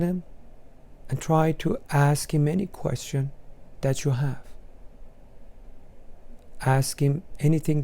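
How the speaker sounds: soft, unhurried meditation voice; guided meditation. Acted. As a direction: guide the listener gently into the imagery. Leads a guided imagery meditation.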